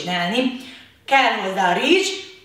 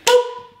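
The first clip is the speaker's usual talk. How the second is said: high squeaky mock pop; balloon pop noise; 12 semitones higher than usual. Imitating a balloon popping. (magas, játékos lufidurranás-hang)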